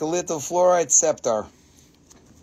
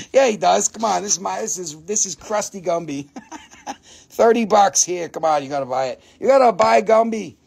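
usual speaking voice

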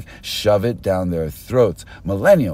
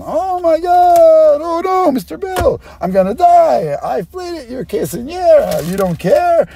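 high, shrill-free mock panic, much higher and louder at first; hammed-up terrified pleading, pitched way up; panicked plea; 3 dB louder than usual. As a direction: beg for your life in a hammed-up high voice. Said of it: "The person saying to them".

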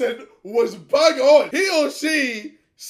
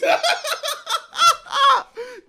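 cracking up, can't stop laughing; hysterical laugh; 12 semitones higher than usual. Real, not acted. Friend cracking up at video.

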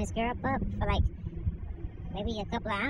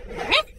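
sharp yelp, much higher; 5 dB louder than usual. Acted. Dog yelps after biting owner's ankles, sharp yelp. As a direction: yelp like a kicked chihuahua.

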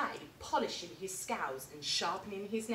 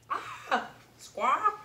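high squawking parrot cry; 8 semitones higher, 6 dB louder than usual. Acted. The parrots screech in terror, high squawk.

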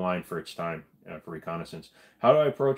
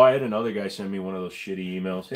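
ordinary delivery

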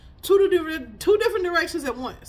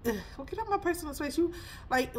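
usual speaking voice

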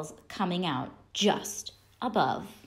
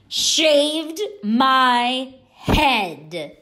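high, louder, slowing scolding yell; 7 semitones higher, 6 dB louder than usual. A narrator's reading. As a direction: scold the copycats at full voice, dragging out the end. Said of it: "Stephanie yelled".

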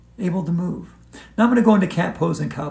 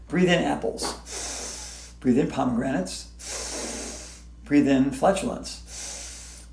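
slow hushed breathe-in cue; soft slow breathing instruction; 5 semitones lower, 8 dB quieter, much slower than usual. Shown as a demonstration. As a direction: draw each breath in slowly. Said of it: "You breathe in three times".

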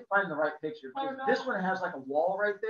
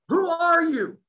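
much higher and louder, demanding; angry demand; 11 semitones higher, 8 dB louder, slower than usual. Retold or imitated. Homeowner to trespassing child, mock angry shout. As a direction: bark it at the intruder.

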